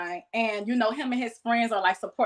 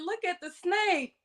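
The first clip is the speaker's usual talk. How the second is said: put-on higher, childlike excited call; little girl voice; 7 semitones higher, 5 dB quieter, slower than usual. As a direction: play her seven-year-old self. Child self voiced in story.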